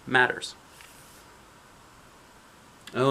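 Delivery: usual speaking voice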